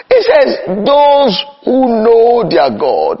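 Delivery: slow, high, drawn-out preacher's cry on "Those"; preaching cry. Acted. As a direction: stretch "Those who know their God" out like a pulpit call. Preaching scripture at full force.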